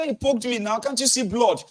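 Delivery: high, complaining plea; high whiny protest; pleading adult. Retold or imitated. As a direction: insist you were poked, near tears.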